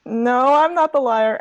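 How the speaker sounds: high, drawn-out protest; protesting denial. Acted. Arguing over who is the liar, protesting. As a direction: stretch out a mock-offended denial.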